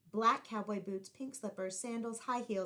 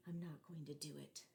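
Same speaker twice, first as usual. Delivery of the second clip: low, much softer muttered to himself; quiet self-talk; 5 semitones lower, 12 dB quieter than usual. Acted. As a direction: say it under your breath. Boy telling himself quietly.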